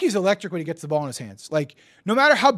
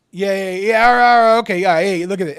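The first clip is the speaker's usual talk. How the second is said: excited outburst; 9 dB louder than usual. Real, not acted. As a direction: react big and loud. Reacting excitedly to something shown.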